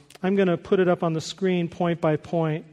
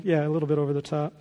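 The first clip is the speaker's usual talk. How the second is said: ordinary talk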